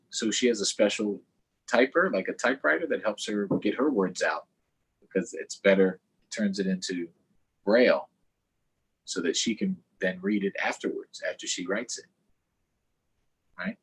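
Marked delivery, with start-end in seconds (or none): none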